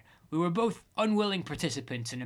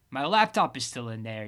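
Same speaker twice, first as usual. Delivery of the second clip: ordinary delivery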